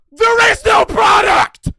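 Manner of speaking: slow, high, full-throated scream with the weight on There; furious shout. Real, not acted. Ranting at a refunding viewer, slow furious scream. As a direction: roar There is no product, stretching each word. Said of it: "Yeah, I'm screaming".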